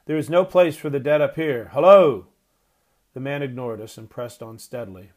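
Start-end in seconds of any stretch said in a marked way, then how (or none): 0.0-2.3 loud, rising shout to a distant man; shouting after someone who ignores you; shouted call. A narrator's reading. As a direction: bellow to make him stop. Grandfather hails the cart man.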